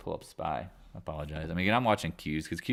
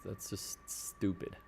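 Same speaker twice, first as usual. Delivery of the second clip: usual speaking voice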